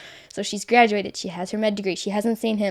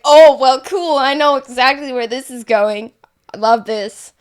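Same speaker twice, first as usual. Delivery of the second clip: louder upbeat oh well cool; excited; 3 semitones higher, 10 dB louder than usual. Retold or imitated. Recalling her reaction to the vision, excited. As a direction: gush with playful delight. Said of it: "I was like".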